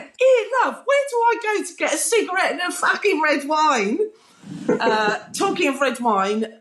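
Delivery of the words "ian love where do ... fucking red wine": high-pitched mock British comic; Eric Idle. British comedian asking a stranger for cigarettes and wine, high hammed-up impression. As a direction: imitate a comedian calling out in a raised, high voice. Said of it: "Eric Idle from Monty Python".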